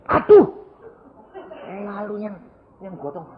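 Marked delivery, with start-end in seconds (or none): 0.1-0.5 sharp, high, loud ouch; yelp of pain. Retold or imitated. Dropped corpse hits his head, sharp high yelp. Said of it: "orang ini bilang-bilang".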